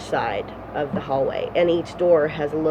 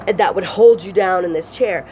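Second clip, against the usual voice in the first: ordinary talk, nothing marked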